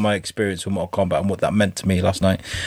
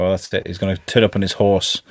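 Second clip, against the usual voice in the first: ordinary talk, nothing marked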